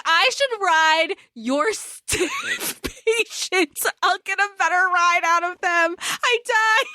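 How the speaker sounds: high-pitched, cracking up mid-quote; laughing through quote. Retold or imitated. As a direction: retell the jibe through helpless laughter. Quotes jibe, breaking into laughter.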